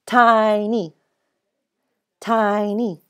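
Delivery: "Tiny" drawn out as TI-ny; split into syllables. Shown as a demonstration. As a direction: stretch the first syllable of "Tiny". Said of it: "Where do I break it?".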